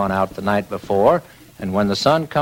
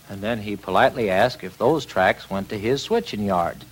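usual speaking voice

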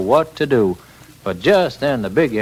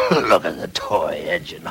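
low voice of a big engine; mock the little one from down low; deep scornful engine voice; big freight engine; 9 semitones lower than usual.

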